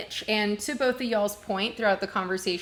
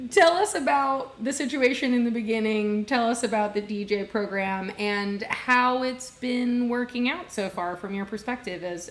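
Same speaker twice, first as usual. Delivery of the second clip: usual speaking voice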